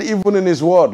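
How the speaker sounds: usual speaking voice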